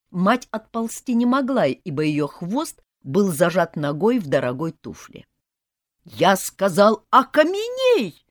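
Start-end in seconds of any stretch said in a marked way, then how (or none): 6.1-8.2 loud, slow, high command; shout. A narrator's reading. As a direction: bellow the spell. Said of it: "закричал питон".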